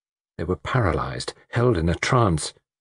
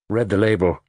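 plain reading voice

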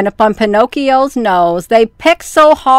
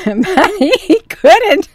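higher, slow, laughing through it; 7 semitones higher, much slower than usual. Real, not acted. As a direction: barely get it out for laughing. Reading while laughing.

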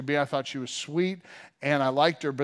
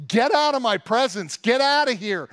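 high, loud command; loud yell; 6 semitones higher, 5 dB louder than usual. Acted. Joseph sends his attendants out, loud yell. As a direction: yell it as an order to clear the room. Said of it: "he yelled at them in a loud voice".

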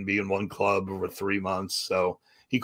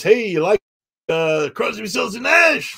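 high, loud hyped-up announcer; rock-show MC whipping up fans; DJ hype; 10 semitones higher, 6 dB louder than usual. Retold or imitated. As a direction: pump up the crowd like a radio DJ. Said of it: "He goes".

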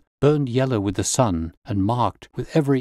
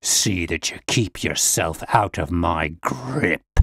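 low, slow, menacing threat; a brute's low snarl; 5 semitones lower, slower than usual. Acted. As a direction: snarl it as a last warning. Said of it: "he snarled".

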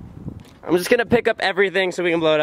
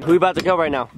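ordinary talk

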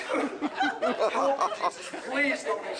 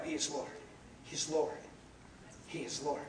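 soft, lower, repeated; quiet declaration; 7 semitones lower, 10 dB quieter than usual. Real, not acted. Preacher to congregation, soft and low. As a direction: let each He is Lord sink in softly. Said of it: "repeated declaration".

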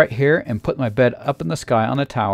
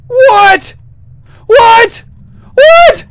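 shrieking much higher and louder in disbelief; shocked shout; 13 dB louder, much slower than usual. Real, not acted. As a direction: scream What? at the screen in shock. Bed fails, shocked outburst.

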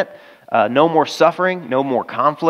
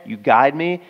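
usual speaking voice

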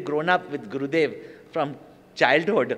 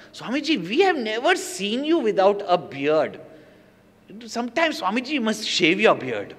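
much higher, teasing coax; mock girlish coaxing; high playful pleading; 5 semitones higher than usual. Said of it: "she's telling to Gurudev".

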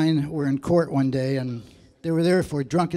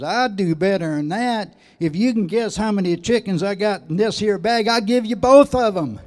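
hillbilly; 6 semitones higher, 7 dB louder than usual. Retold or imitated. Hillbilly guy answers.

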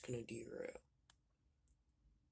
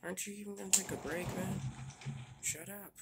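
irritated; 5 semitones higher, 5 dB louder than usual. Acted. Quarrel as engine leaves.

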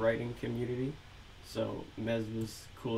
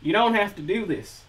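put-on southern accent; 9 semitones higher, 8 dB louder than usual. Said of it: "really bad southern accent for Scumbag Skeeter".

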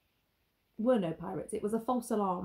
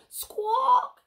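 high, loud squawking parrot cry; parrot screech; 9 dB louder than usual. Acted. The captain's parrot screeching at the village, shrill squawk. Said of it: "screeched the captain's parrot".

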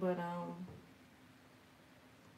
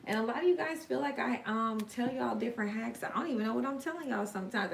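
normal talking voice

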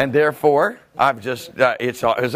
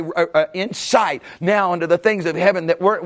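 usual speaking voice

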